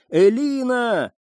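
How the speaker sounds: high, slow, drawn-out call; desperate call. A narrator's reading. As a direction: stretch the name out, calling into the woods. Searching, calling her name.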